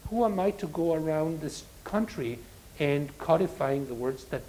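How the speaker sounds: usual speaking voice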